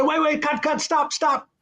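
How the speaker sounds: high, hurried mock call to stop; urgent cut. Director stopping the take, high and urgent. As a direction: halt the take fast and high. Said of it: "I said".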